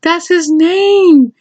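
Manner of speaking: high, drawn-out motherly correction; amused mom. Retold or imitated. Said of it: "my mom started laughing".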